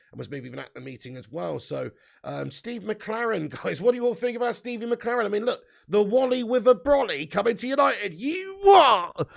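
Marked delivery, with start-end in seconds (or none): 5.9-9.3 high, loud hammed-up disbelief; mock outrage. Streamer teasing viewers about the new signing, mock outraged. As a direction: build to a yelled joke protest. Mocks the appointment in joke outrage.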